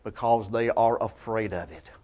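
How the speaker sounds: soft, low statement of the point; quiet, measured and grave. Real, not acted. Preacher's closing point, then repeated.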